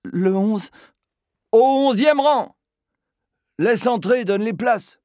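high, loud commanding voice; loud orders. Acted. Brisk shouted commands. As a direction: Philippe barking seat orders to Bagvaline.

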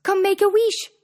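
high, loud invitation; fairy's cry. A narrator's reading. Fairy offers Cindy a wish, high cry. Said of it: "she cried".

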